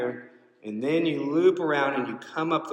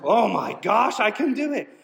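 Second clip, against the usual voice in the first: high, louder rush of emotion; overwhelmed runner realising he'll make it; emotional; 10 semitones higher, 5 dB louder than usual. Retold or imitated. Exhausted runner hearing his friend cheer, high emotional burst. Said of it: "I'm getting emotional now".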